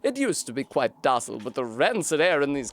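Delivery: a kindly-sounding but scheming mystic offering a gift; British snail shaman. Acted. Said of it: "I'm British, apparently".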